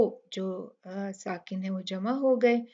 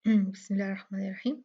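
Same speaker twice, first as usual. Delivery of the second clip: rapid recitation of the bismillah; fast recitation; quick bismillah; much faster than usual. Shown as a demonstration. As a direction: rattle off the opening formula. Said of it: "तजवीद के कायदे इन आयात में".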